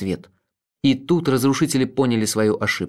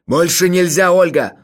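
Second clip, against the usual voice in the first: loud, raised outburst; shouting; 6 semitones higher, 6 dB louder than usual. A narrator's reading. Leonid can't hold back, shouts at Olga. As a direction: shout as nerves give way. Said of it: "закричал он".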